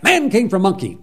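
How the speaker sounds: put-on a stubborn little boy digging in; defiant child. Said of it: "I was really defiant".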